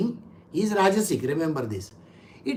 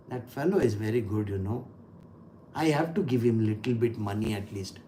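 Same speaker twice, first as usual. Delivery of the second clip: father's voice; 11 semitones lower, 9 dB quieter than usual. Retold or imitated. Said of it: "but yet he will say".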